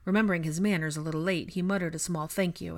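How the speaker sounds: plain reading voice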